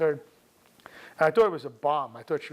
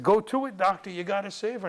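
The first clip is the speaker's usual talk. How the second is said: high, loud rallying cry; pushing, raised-voice plea; urgent urging; 7 semitones higher, 5 dB louder than usual. Retold or imitated. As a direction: imitate men shouting the doctor on. Stable hands urge him back in.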